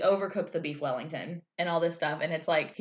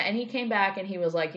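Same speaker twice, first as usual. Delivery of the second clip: ordinary talk, nothing marked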